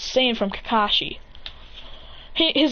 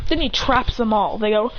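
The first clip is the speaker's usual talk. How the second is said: plain reading voice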